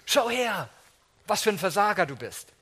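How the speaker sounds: imagined Jesus mocking Peter, scornful and loud; mock taunt. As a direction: rub Peter's failure in.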